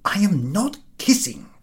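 high, hurried, breathy protest; flustered denial. A narrator's reading. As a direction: deny it in a fluster. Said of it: "said Ahiniv in confusion".

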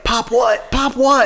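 high, rising, insistent question; puzzled, demanding. Retold or imitated. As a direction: demand the rest of the line. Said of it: "and now it's like".

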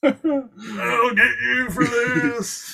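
slow high mock wail; hammy villain cry. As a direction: wail it like a beaten cartoon villain. Mock defeated villain line.

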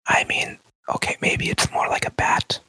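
hushed, voiceless whisper; whispered in character. Acted. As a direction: whisper it like you might be overheard. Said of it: "don't actually whisper".

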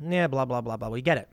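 low, bored blah-blah; dismissive skimming. Real, not acted. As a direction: drop the pitch and wave away the boring text. Skips dull part of article.

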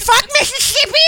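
shouted with spit spraying; spit take. Real, not acted. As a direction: yell it so spit flies. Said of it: "Oh, I just spit everywhere".

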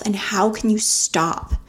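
usual speaking voice

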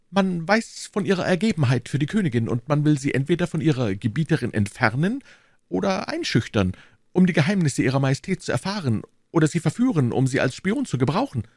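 a hint of a nervous informant; anxious bourgeois. Narrator plays the bourgeois.

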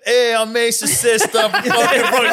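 put-on high, loud laughing girly voice; a hammed-up Brooklyn sister, laughing through it; mock sister voice, way up high; impersonating his sister. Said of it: "do an impersonation of your sister in Brooklyn".

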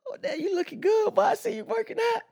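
high falsetto voice, hammed-up flattery; falsetto compliment. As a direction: squeal the praise like an admiring fan.

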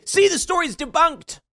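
high, sing-song mock triumph; mocking gloat. Mimicking pro-Palestine gloaters in a high smug taunt.

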